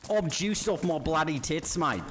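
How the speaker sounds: lightly hammed Aussie mate voice; a hint of a rowdy Australian; Australian accent. Aussie bloke voice again.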